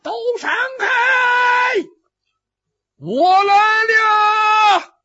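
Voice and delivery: high, drawn-out battlefield holler; distant shout. A narrator's reading. Newcomer warns fighters to clear the way, long shout. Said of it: "就听远处有人喊".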